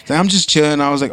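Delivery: ordinary talk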